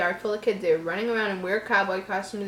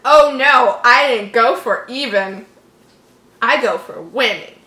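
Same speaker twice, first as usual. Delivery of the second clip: menacing bully; 9 dB louder than usual. Acted. Bully Randy threatening Jeff.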